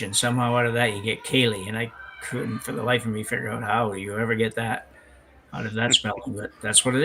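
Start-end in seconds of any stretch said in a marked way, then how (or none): 1.2-1.7 "Kaylee" for the word spelled C-I-E-L-I-D-H; Kaylee pronunciation. Shown as a demonstration. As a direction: say it as "Kaylee", ignoring the odd spelling. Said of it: "spelled C I E L I D H".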